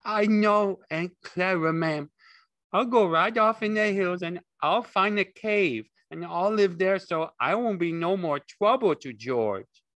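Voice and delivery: high, loud plaintive promise; grieving moan. A narrator's reading. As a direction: moan it in a simpleton's grief. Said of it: "Lenny moaned with grief".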